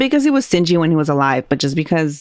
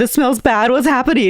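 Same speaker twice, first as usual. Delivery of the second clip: mock panic; 7 semitones higher than usual. Caller panicking after ghost visit.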